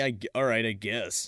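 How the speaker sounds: low, soft, reluctant give-in; grudging. Acted. Brother gives in to scolding sister, low grudging mumble. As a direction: trail down into a sulky agreement.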